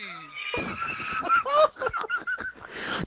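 long high-pitched squealing laugh; shrieking laugh. Real, not acted. Friend cracking up at a girlfriend roast, shrieking laugh.

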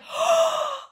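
high, sudden gasp of realisation; sharp gasp. Retold or imitated. Realising the cat is her late sister, a sharp gasp. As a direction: gasp as it dawns on you.

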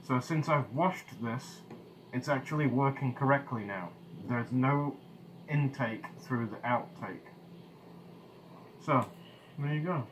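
muffled behind mask visor; speaking inside respirator; through a gas mask. Real, not acted. Seen 5: Full-face gas mask covers mouth while speaking; removed only in refs.

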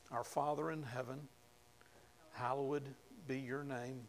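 soft, hushed reading of the Lord's Prayer; preacher murmurs the prayer to his congregation, softly; quiet prayer recitation. Acted.

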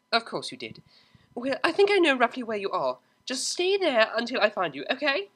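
high, firm serious voice; serious posh mare. A narrator's reading. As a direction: insist gravely on safety. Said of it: "sounded so serious".

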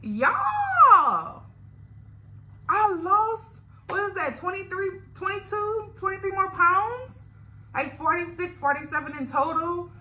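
high, loud, amazed exclamation; thrilled. Real, not acted. Vlogger sees her weight loss on the scale, thrilled and loud. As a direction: burst out in joy at the number.

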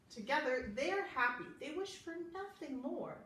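ordinary delivery

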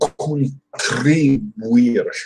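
'Contribuir.' drawn out slowly, every syllable spelled out; slow careful retry. Shown as a demonstration. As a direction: ease through 'Contribuir.' slowly, testing the tr. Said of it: "that's tricky".

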